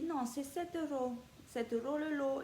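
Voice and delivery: imitating the cashier's strong Italian accent. Leonardo insisting on the lot price, mock Italian accent. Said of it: "il a un fort accent italien".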